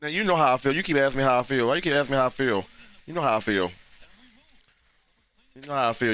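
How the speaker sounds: ordinary talk, nothing marked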